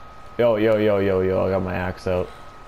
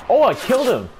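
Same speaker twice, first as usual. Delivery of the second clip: excited; 13 semitones higher, 4 dB louder than usual. Real, not acted. Gets kill.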